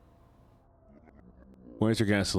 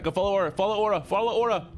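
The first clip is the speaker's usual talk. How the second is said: excited hype; 7 semitones higher than usual. Real, not acted. Hyping a follow.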